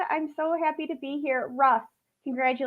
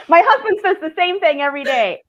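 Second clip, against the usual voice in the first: louder, laughing through the words; 5 semitones higher, 9 dB louder than usual. Real, not acted. Guest laughing about her husband's daily teasing, laughing. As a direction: giggle it out, amused. Laughing at host's talkative habit.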